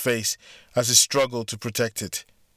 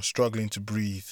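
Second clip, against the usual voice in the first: ordinary delivery, nothing marked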